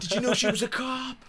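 high squeaky movie quote; high mock voice. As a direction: pitch it up high. Quoting a movie line.

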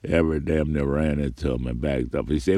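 usual speaking voice

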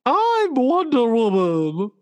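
high, slow put-on heroine voice; a high, slow superhero voice; Wonder Woman voice. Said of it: "why are you talking like that".

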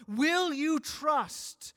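loud, very slow plea; crying out to the people, loud and slow. Acted. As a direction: call it out slowly and loudly. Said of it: "God is crying out and saying".

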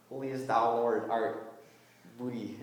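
put-on low, half-hearted pirate accent; terrible pirate accent. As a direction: try a bad pirate accent, softening at the end.

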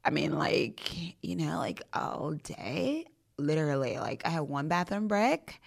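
put-on drawling, croaky Valley Girl with rising ends; frog-in-the-throat Valley Girl, dragged out. Bragging about a busy day, croaky Valley Girl. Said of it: "millennial kind of Valley Girl".

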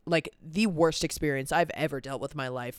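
ordinary delivery

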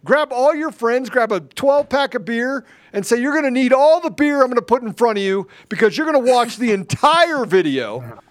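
loud, high rallying cry to the audience; hyped loud pitch to viewers; fired-up shouting. Real, not acted. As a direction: rouse the crowd, full voice.

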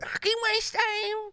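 high, slow hammed-up geezer. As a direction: bark it like a football geezer. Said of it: "the geezer".

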